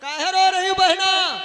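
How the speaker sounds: high, loud plaintive call; sage calling out. Acted. Narad presses the sister to say why she weeps, calling out. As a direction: plead gently but loudly.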